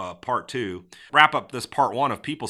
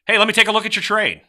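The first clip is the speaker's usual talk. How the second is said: high, loud, eager pitch; upbeat tone; 10 semitones higher, 8 dB louder than usual. Shown as a demonstration. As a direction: call it out bright-eyed and keen. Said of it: "ways of saying the exact same sentence".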